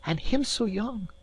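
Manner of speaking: high, pitying exclamation; pitying cry. Acted. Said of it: "cries the lass".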